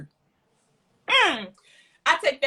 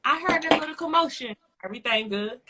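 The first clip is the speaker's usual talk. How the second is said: officer voice; 3 semitones higher than usual. Retold or imitated. Voicing officer.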